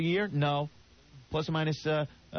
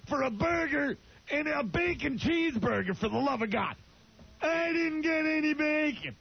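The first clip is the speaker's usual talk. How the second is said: Don West impression; 6 semitones higher than usual. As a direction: bellow complaints like a cranky shopping-channel host. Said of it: "I can't do the voice, but he was, like".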